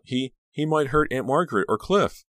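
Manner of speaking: high, anxious stammer; choked out. A narrator's reading. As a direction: blurt the fear for your family. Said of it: "Danielle choked out".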